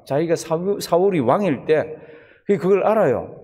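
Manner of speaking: ordinary delivery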